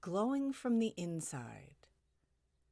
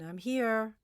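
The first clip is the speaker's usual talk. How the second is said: raised, lifted call into a house; 5 semitones higher, 8 dB louder than usual. Acted. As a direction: call out on arriving, higher and louder. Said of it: "and you call in".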